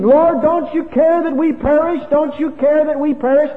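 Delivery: high, loud terrified cry; panicked scream. Retold or imitated. Frightened disciples scream at sleeping Jesus, high and loud. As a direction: cry out to the Lord as the boat sinks. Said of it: "these men screaming".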